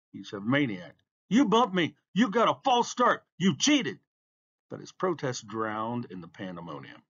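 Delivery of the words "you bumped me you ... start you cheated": high-pitched rapid-fire blame; indignant accusation. A narrator's reading. Beaten racer accusing the winner, indignant. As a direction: hurl each charge as a raised protest.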